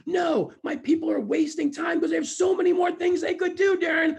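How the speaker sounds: high, loud shouted protest; upset retort. Retold or imitated. Upset boss objecting to Darren, shouted. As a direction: imitate a boss yelling in exasperation. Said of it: "watch them get upset with".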